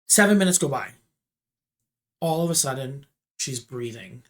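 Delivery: plain reading voice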